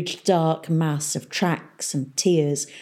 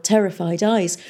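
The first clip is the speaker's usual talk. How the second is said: ordinary delivery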